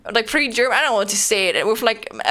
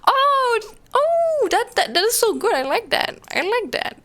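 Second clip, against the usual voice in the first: delighted squeal, much higher; thrilled food reaction, pitched way up; excited; 12 semitones higher than usual. Real, not acted. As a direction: gush over it with a high, thrilled voice.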